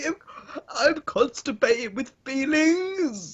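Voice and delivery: high, slow, straining fake cry; strained mock sobbing. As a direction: wail it like giving birth. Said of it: "You sound like you're giving birth".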